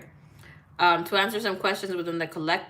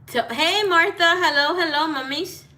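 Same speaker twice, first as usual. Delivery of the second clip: excited greeting; 9 semitones higher, 4 dB louder than usual. Real, not acted. Greeting viewers joining the stream.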